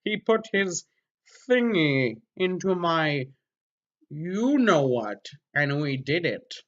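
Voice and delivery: slow, stilted alien voice. Acted. Mocking the fanfic as an alien, slow and stilted. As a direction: read it like an alien puzzling out sex. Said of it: "sounds like an alien who doesn't know how to describe sex".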